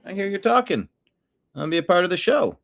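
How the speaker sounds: voicing the cat. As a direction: speak up high for your pet. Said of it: "Nimbus is like".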